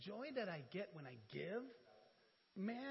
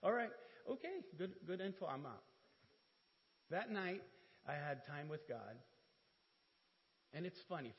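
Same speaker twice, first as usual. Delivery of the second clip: usual speaking voice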